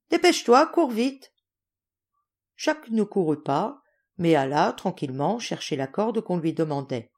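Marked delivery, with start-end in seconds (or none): 0.1-1.3 high, insistent hurry-up order; imperious child. Acted. Mitineka ordering Jacques to run for the rope, imperious. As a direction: push him to run, bossy and high. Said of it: "ton impérieux de Mitineka".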